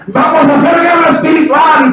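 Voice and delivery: high, hammed-up worship leader's call; mock rallying shout. Preacher mocks concert worship leaders, high rallying shout. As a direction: imitate a leader whipping up the crowd.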